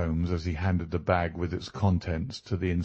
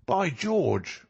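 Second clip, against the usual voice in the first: high-pitched exclamation of the inspector; astonished cry; 8 semitones higher than usual. A narrator's reading. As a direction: cry out in amazement, pitch leaping up. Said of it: "cried the inspector".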